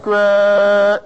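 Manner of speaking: Gra- stretched on a long held eh; long held eh vowel. Shown as a demonstration. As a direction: hold the eh in Gra-. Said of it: "eh, eh is what's held".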